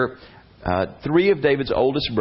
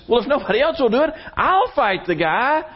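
put-on much higher, boyish volunteer voice; eager young David; 12 semitones higher than usual. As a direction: pipe up like a brave kid.

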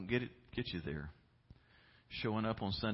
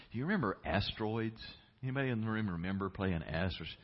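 ordinary talk

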